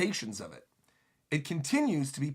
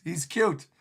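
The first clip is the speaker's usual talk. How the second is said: ordinary talk, nothing marked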